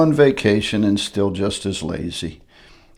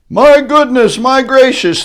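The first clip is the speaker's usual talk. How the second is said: high, loud amazed cry; shouted; 13 semitones higher, 7 dB louder than usual. Acted. Cheering crowd at the elephant bird, high loud amazement. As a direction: cry out in wonder. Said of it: "they shouted".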